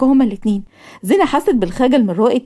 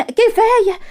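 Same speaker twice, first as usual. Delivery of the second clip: much higher, drawn-out كفاية with a falling end; emphatic high enough; 10 semitones higher, much slower than usual. Acted. Mother telling Zein enough, high emphatic كفاية. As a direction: lift كفاية high then let it fall. Mother pleased by Zein's visit.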